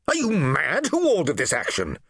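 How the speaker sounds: high indignant demand; angry challenge. Acted. Praetorian officer confronting the legate over the gates, angry. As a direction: confront him, furious.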